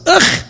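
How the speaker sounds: a loud, high groan of self-disgust; contrite groan. Retold or imitated. A penitent groaning at his own sins, a loud high groan. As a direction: groan as if your heart just broke. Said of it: "looks at himself and says".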